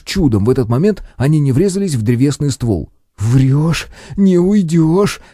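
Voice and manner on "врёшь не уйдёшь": hissing. Acted. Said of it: "прошипел кудесник".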